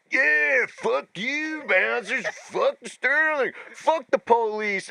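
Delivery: high-pitched mock heckling yell; retold yelling. Retelling himself cursing bouncers outside the venue, mock yelling. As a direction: draw out each jeer, pitched high. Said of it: "I saw them yelling at the bouncers".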